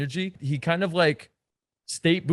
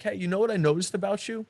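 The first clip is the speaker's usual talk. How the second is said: mock-serious, higher opener that drains the fun; serious teasing line; 6 semitones higher than usual. Shown as a demonstration. A coach showing a guy teasing a girl too seriously. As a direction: say it with a heavy, serious undertone. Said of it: "that seriousness brings the vibe down".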